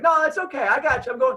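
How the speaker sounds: high, loud call over the shoulder; hurried brush-off. Retold or imitated. Brushing off police while walking away.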